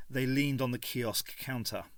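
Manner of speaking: ordinary delivery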